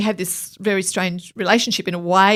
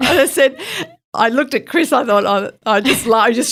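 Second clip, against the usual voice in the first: laughing; 5 semitones higher, 5 dB louder than usual. Real, not acted. Laughing at the memory.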